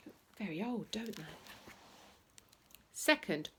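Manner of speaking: ordinary delivery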